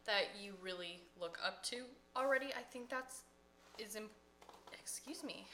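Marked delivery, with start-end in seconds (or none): 2.5-5.5 talking through hiccups; words broken by hiccups. Real, not acted. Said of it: "hiccuping a lot".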